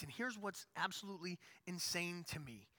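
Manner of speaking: usual speaking voice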